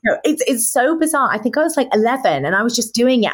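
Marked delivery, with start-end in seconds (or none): none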